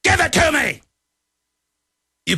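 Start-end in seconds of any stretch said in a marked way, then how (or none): 0.0-0.8 much higher mock shout as a smug trendy liberal. Host mocking trendy liberals on air, high mock shout. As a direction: yell it up high like a sneering caricature. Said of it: "And they just go".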